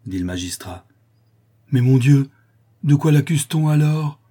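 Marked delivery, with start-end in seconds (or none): 1.7-4.2 loud, high, frantic question; agitated plea, voice raised; exasperated outburst. A narrator's reading. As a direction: demand the charge in desperation. Father exasperated by unnamed accusation.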